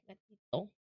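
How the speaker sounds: gatito said softly, almost to herself. Shown as a demonstration. Correcting chat on her cat's word, softly. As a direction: breathe gatito gently. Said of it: "he's a gatito".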